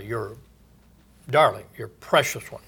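ordinary talk

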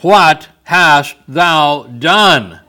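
very slow, loud rebuke as Samuel; stern prophet. Acted. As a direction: let Samuel's question land hard and slow. Samuel confronts Saul.

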